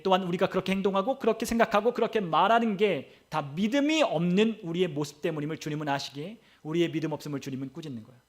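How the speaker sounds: ordinary talk, nothing marked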